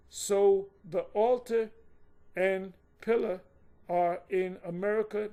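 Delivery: slow and deliberate, broken by pauses; slow, emphatic re-reading. Shown as a demonstration. Teacher re-reading the point to listeners, slow and emphatic. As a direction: spell it out phrase by phrase, loud on "altar". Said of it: "Let me read that again".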